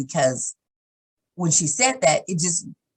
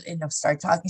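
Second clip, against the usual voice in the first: ordinary talk, nothing marked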